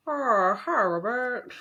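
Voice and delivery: hammed-up "oh", then the greeting; Jennifer Coolidge. Shown as a demonstration. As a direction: greet in a tentative star impersonation. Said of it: "Jennifer Coolidge?".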